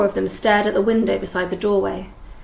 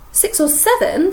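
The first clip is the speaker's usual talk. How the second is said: eager; 8 semitones higher, 5 dB louder than usual. Acted. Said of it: "said Ron eagerly".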